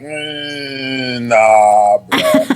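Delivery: low, drawn-out doubting hum and brush-off; retold reply, unconvinced and low; dismissive disbelief. Speaker replaying his response to people's stories, low dismissive disbelief.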